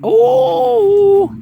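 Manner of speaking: high, loud drawn-out cry of joy; thrilled gardener finds another carrot; joyful cry. Real, not acted. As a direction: let the delight ring out, slowly. Sees more carrots, cries out.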